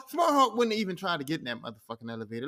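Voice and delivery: usual speaking voice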